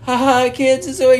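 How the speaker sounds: high, fake-jolly reassurance; forced laughter. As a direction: imitate someone laughing under threat. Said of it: "so go ahead and laugh".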